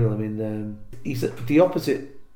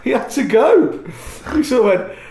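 real laughter breaking through the words, high and loud; laughing; 12 semitones higher, 7 dB louder, slower than usual. Real, not acted. Laughing while telling the story.

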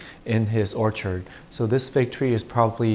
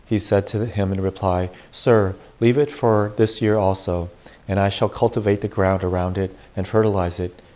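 louder, formal proclamation from the pulpit; Gospel reading; 4 dB louder than usual. Acted. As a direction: read scripture to the congregation.

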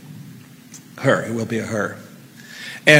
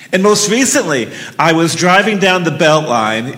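plain reading voice

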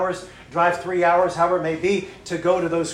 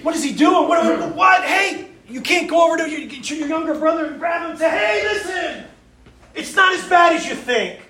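high, loud, urgent pleading; frantic inner cry to a suicidal brother; frantic shouting; 11 semitones higher, 4 dB louder than usual. Retold or imitated. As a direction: yell it as if you could reach him. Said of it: "and you're thinking".

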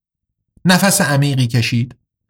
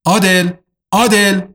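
calling a name out in alarm, rising on the repeat; 5 semitones higher than usual. Acted. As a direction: shout the name to wake him, higher the second time. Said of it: "داد زد". (فریاد زدن نام با نگرانی)

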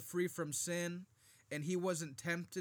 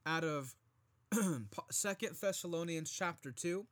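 ordinary talk, nothing marked

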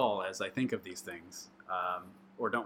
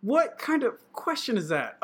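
high, loud, incredulous; incredulous protest; 13 semitones higher, 5 dB louder than usual. Real, not acted. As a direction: balk loudly at an impossible question. Put on the spot by question.

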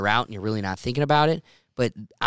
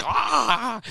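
put-on drunk whoop; 11 semitones higher than usual.

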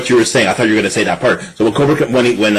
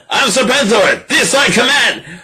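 pitched-up, imperious declaration; Serpentor proclaiming; 9 semitones higher, slower than usual. Retold or imitated. New emperor refusing to answer to anyone, high grand proclamation. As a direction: proclaim it high, stretching the command.

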